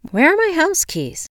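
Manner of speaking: high, loud sudden alarm; alarmed question out loud. A narrator's reading. Locked-out woman searching for her house keys, alarmed. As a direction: call it out in a rising panic, pitched high. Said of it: "I asked out loud".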